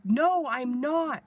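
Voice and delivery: retold yelling. Patient protesting to the dismissive nurse, retold yelling. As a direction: act out pleading loudly through pain. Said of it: "I was, like, yelling".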